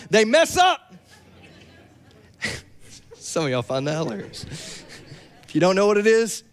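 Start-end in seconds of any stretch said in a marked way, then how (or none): 0.1-0.9 meme catchphrase. Retold or imitated. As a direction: belt the meme line. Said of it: "A-, Aaron".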